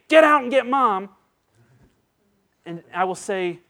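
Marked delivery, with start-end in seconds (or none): 0.1-1.1 high, loud mock outburst; hammed-up girl ordering dad out; upset daughter. Upset daughter sending dad away, high and loud. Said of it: "if she doesn't say".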